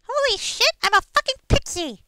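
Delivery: tiny high squeaky fairy voice; pixie voice. Pretend. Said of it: "my pixie voice".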